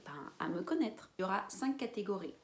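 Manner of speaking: usual speaking voice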